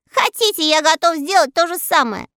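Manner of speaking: high, loud cocky squeak; boastful little voice. A narrator's reading. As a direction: brag without fear. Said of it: "хвастливый голосок Мурзилки". (хвастливый писклявый голосок)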